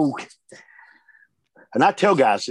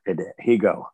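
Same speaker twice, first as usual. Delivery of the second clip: normal talking voice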